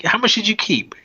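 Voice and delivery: mock indignant question. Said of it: "And he's like".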